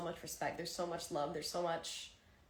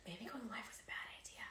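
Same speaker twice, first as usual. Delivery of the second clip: unvoiced, barely there; whisper; 14 dB quieter than usual. Real, not acted. Streamer whispering doubts to herself mid-stream. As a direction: mutter it to yourself in a whisper. Overwhelmed during livestream.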